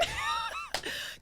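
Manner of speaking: high squealing laugh; helpless high giggle; real laugh. Real, not acted. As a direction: burst out in high-pitched laughter. Laughs at own answer.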